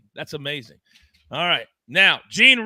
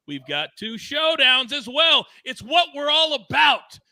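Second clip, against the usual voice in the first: announcer sign-off; 5 dB louder than usual. Acted. Show sign-off hype.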